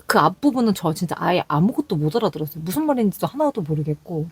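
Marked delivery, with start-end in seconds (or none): none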